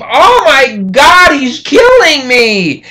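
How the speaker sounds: high, loud, slow cry of terror; retold scream of terror. Teacher voicing the victim screaming as she is attacked, high and loud. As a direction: scream as if being killed. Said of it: "she starts screaming".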